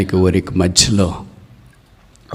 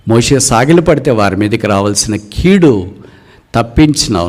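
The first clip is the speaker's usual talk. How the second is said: ordinary talk, nothing marked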